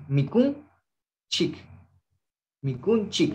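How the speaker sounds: Mikun and chik said apart, with a pause between; model pronunciation. Shown as a demonstration. Teacher splitting the word for students. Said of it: "drills the class".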